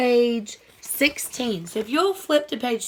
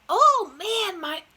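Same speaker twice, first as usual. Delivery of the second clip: dazzled Toad; 8 semitones higher, much slower than usual. Acted. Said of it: "And Toad said".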